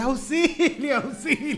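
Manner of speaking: high, loud auxilio through laughter; retold cry from the car door; laughing yell for help. Boy calling for help out the car door, laughing yell. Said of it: "grité".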